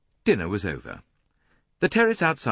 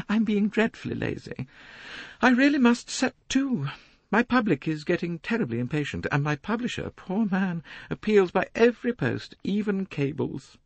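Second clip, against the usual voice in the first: much higher, a self-important lady complaining; a fussy society matron, preening. A narrator's reading. Mrs Otterbourne voiced by the narrator.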